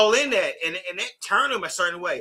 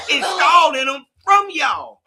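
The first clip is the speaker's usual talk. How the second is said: emphatic shout; 8 semitones higher, 5 dB louder, slower than usual. Real, not acted. Arguing, shouting point.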